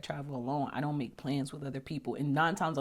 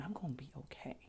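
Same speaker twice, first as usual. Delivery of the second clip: hushed reassurance; 15 dB quieter, faster than usual. Retold or imitated. Calming herself mid-air.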